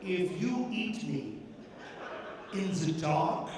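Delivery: low, soft, sly German-tinged seduction; Haagen-Dazs voice.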